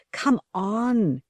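high call into the wind; shouting. A narrator's reading. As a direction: call him up the hill. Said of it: "she shouted".

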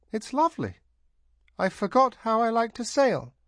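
delighted. A narrator's reading. Tanar delighted at sailing.

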